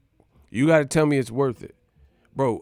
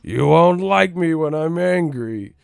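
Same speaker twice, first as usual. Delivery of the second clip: loud, slow monster growl impression; booming mock menace, slow and heavy; Hulk; 3 semitones higher, 7 dB louder, slower than usual. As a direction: drag it out like an angry giant. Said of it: "you must be the Hulk".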